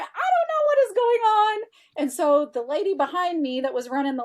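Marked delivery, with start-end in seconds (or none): 0.0-1.6 high, loud flustered disbelief; bewildered. Retold or imitated. Retelling her shock at the retreat, high bewildered cry. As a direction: blurt it out, totally thrown and much higher.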